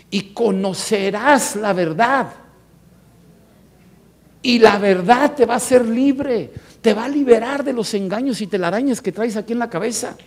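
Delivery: high, loud proclaiming; lifted, ringing sermon voice; forceful preaching. Acted. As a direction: proclaim the promise to the whole church. Preacher quoting Jesus forcefully.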